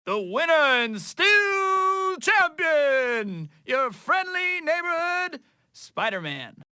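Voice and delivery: high, drawn-out boxing announcer call; mock fight announcer, slow and high-pitched; ring announcer. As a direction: announce the champion, stretching the words out long. Announces himself champion after a fight.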